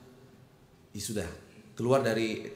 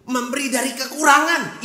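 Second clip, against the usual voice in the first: loud, high fiery sermon voice; preaching at full force; 13 semitones higher, 11 dB louder, slower than usual. Acted. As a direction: thunder it to the congregation.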